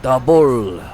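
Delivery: "The Bull" announced loud and grand; mock grand. Shown as a demonstration. Translating the name for viewers, loud mock grandeur. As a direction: boom out "The Bull" like a title. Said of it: "That's more Spanish".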